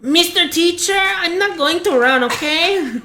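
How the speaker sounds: high, mocking schoolgirl voice; mocking a Hot Cheeto girl. Friend mimics a classmate talking back to the teacher, high mocking voice. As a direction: sass it up like a bored girl at lunch. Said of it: "they're like".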